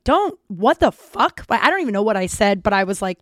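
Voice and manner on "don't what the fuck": slow, louder, higher indignant retelling; indignant call-out. Retold or imitated. She calls out her friend, indignant. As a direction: re-enact telling him off, drawn out. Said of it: "I called him out".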